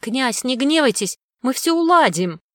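high, placating servile voice; bleating underling. A narrator's reading. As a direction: bleat appeasingly to an angry master. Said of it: "заблеял один из его подчинённых".

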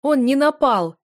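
high, loud cry of protest; shouted. A narrator's reading. Wife shouting back at her husband, loud. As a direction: shout him down. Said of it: "выкрикнула я".

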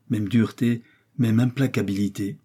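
plain reading voice

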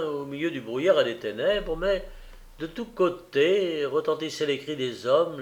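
ordinary delivery, nothing marked

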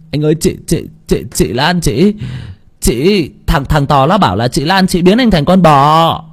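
put-on high, halting nervous child; high flustered stutter; stammering. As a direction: struggle to get the words out. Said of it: "ấp a ấp úng".

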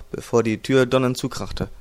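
ordinary delivery, nothing marked

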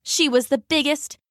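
plain reading voice

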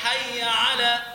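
حي على with a slow, loud, held يّ; doubled ya stressed. Shown as a demonstration. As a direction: lean hard on the shadda in حي. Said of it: "وليس حي على".

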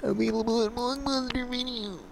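high announcer voice. Pretend. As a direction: sell it like a cheesy promo. Mock ad and news announcer.

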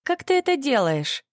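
eager, high and bright question; excited. A narrator's reading. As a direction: ask full of delight. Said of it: "восторженно спросила одноклассница".

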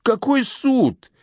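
high, drawn-out disbelieving exclamation; incredulous scoff. Acted. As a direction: scoff at a naive question, pitch leaping up. Scoffing at naive colleague's question.

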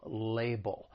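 "label" as LAY-bul, weight on LAY; "label" stressed at the front; stress on first syllable. Shown as a demonstration. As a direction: model "label" with a falling, unstressed second syllable. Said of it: "The stress is on that first syllable".

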